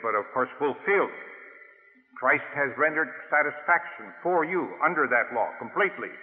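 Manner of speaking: ordinary talk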